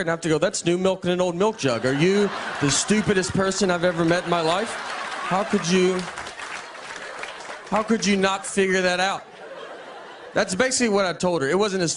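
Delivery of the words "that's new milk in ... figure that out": raised, hammed-up disbelief; mock exasperated scolding. Husband scolding his wife over expired pills, mock exasperation. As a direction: play at berating someone over something obvious.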